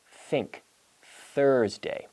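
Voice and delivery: "Think" and "Thursday" with a voiceless th and the tongue out. Shown as a demonstration. Teacher modelling the voiceless th for students. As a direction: blow air over the tongue for "Think". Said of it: "a sound like".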